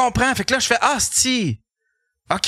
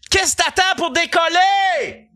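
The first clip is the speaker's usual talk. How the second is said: imitating a yell; 9 semitones higher, slower than usual. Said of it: "elle me crie après".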